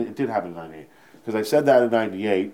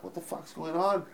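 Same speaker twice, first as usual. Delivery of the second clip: mock panic; 7 semitones higher, 9 dB quieter than usual. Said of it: "I'm panicking".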